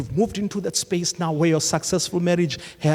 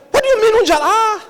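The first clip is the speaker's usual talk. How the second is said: very high, loud puzzled question; mock confusion; 7 dB louder, faster than usual. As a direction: play the baffled outsider. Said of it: "You're like".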